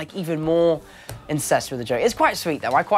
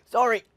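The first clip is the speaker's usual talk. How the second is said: imitated coughing with a higher choked sorry; mock coughing fit; 6 semitones higher than usual. Actor retelling his first on-camera cigarette, mock coughing. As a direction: act out choking on smoke. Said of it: "I was like".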